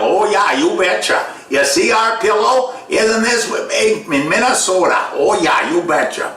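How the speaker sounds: high-pitched, hammed-up Minnesotan TV pitchman; mock folksy salesman from Minnesota; pillow guy. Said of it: "is that pillow guy".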